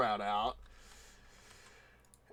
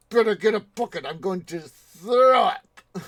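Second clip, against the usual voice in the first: loud, high mock outburst; hammed-up sick diner demanding help; Mister Creosote; 6 semitones higher, 9 dB louder than usual. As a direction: bellow it, stretching the last words. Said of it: "Mister Creosote says".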